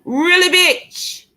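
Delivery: high, slow, drawn-out squeal; corny voice. Shown as a demonstration. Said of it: "In my corny voice".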